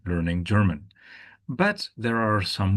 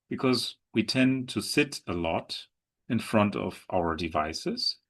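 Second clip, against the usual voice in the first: ordinary talk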